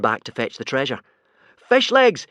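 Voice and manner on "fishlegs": high, loud desperate yell. A narrator's reading. Hiccup yelling to Fishlegs on burning boat, desperate shout. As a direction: call out to him in panic. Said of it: "yelled Hiccup desperately".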